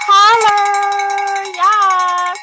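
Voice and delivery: loud high holler to the crowd; high-pitched shout to everyone. Real, not acted. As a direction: call it out, raise the roof. Calling out to viewers.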